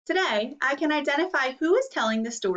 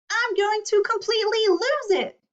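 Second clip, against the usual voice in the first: exasperated outburst; 10 semitones higher, 3 dB louder than usual. Acted. Purple Crayon's outburst.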